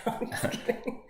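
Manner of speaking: laughing through a joke. Real, not acted. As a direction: giggle it off as a tease. Laughing off a teasing remark.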